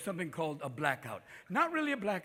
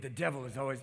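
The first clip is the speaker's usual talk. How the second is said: ordinary delivery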